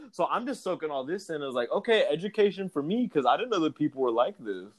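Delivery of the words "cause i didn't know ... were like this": ordinary talk, nothing marked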